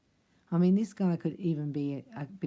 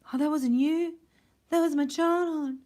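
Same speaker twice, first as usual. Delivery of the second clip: high-pitched acted epiphany; high, dawning self-discovery; sudden realization; 10 semitones higher than usual. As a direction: realize it aloud, voice lifted right up. Acting out his realization about childhood.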